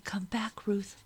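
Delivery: soft, voiceless whisper; tender whispered plea to a sister. A narrator's reading. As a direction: coax her awake under your breath. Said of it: "I whispered".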